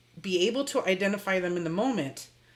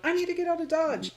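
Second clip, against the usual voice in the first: mock panic; 8 semitones higher, 9 dB louder than usual. Voicing a fight-or-flight panic.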